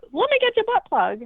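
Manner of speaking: hammed-up high novel voice; mock sultry voice. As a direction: pitch it way up like a silly audiobook character. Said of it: "It's like".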